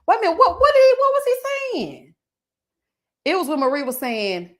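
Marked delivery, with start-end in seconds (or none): 0.1-2.1 high, loud bewildered question; sudden disbelief; startled confusion. Real, not acted. Vlogger reading a baffling comment, startled.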